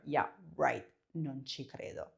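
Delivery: "non ci credo" dropped low and quiet, a sneering doubt; mean, sarcastic. Shown as a demonstration. Student's claim, sarcastic, mean disbelief. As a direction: scoff "non ci credo" like you think it's a lie. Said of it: "make it sound nicer or meaner".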